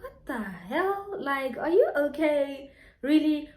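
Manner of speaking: retelling her disbelief at his question; incredulous. Retold or imitated. She answers the ex who asked about her flower, incredulous. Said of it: "I was like".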